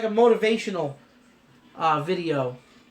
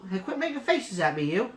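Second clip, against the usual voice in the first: ordinary talk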